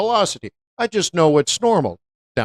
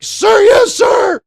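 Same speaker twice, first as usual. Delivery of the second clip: barked, much higher soldier's shout; military yes sir; 6 dB louder, much slower than usual. Retold or imitated. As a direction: snap to attention like a recruit. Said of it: "I'm like".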